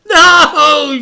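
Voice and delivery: high, loud anguished cry; a boy shouting as a car explodes. A narrator's reading. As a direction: scream the word out in horror. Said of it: "shouted Frank".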